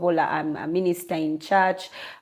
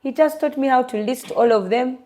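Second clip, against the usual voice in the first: normal talking voice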